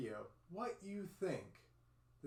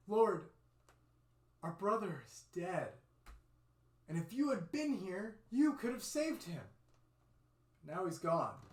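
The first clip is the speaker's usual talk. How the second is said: higher, louder lament; grieving Mary; 4 semitones higher, 5 dB louder than usual. Acted. Mary tells Jesus her brother died, lamenting. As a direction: pour out the sorrow to the Lord.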